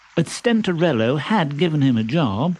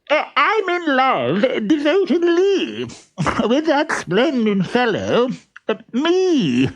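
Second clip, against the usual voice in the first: very high, pompous suitor voice; silly pompous voice; 12 semitones higher than usual. Acted. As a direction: play a vain fool, high and squeaky. Stentorello in trunk.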